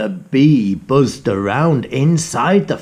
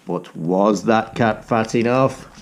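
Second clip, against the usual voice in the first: usual speaking voice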